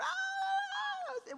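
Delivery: very high, loud mock wail; piercing imitated cry; hysterical baby scream. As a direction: imitate a baby shrieking for food. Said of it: "like, hysterical".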